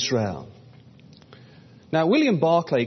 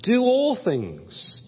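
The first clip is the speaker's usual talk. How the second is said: "all" drawn out and high; stress on all; 5 semitones higher, much slower than usual. Shown as a demonstration. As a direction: lean slowly on "all". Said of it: "We, we've got that idea of all again".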